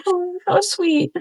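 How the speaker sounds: high, slowed, tender coo; touched by a seller's first-customer gift; touched squeal. Real, not acted. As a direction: melt at a kind gesture. Reading first customer note.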